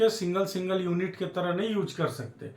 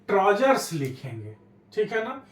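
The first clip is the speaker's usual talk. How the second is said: ordinary talk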